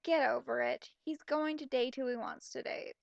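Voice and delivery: ordinary talk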